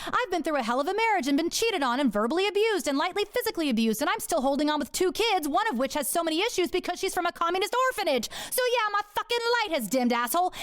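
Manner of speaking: high, heated, fast rant; snapping back. Retold or imitated. To Robert after his insult, a heated high rant. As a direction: snap it back at him, fed up and raw. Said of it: "I snapped back".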